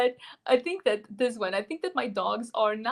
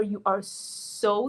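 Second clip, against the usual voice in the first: ordinary talk, nothing marked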